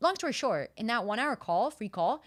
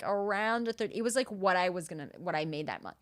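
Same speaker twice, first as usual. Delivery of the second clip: ordinary talk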